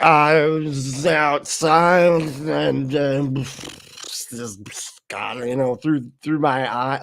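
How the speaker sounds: very slow, drawn-out improvising; stalling mock answer. Woman put on the spot by preacher, stalling. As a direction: stretch the words while making up an answer.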